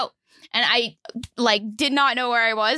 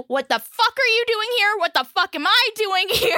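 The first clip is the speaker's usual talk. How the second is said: mock scream; 8 semitones higher than usual. Retelling waking up concussed, yelling at the teacher. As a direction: yell it in a panic, half laughing. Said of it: "just screaming".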